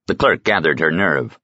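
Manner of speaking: plain reading voice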